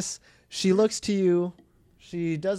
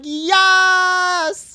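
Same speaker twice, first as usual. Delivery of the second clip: cheering; 9 semitones higher, 6 dB louder than usual. Retold or imitated. Friends cheering in retold scene.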